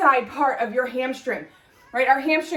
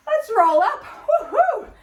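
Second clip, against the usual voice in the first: excited cheer; 9 semitones higher, 3 dB louder than usual. Real, not acted. Cheering as set ends.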